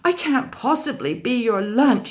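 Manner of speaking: loud, high panicky protest. Acted. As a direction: protest in a rush. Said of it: "he protested".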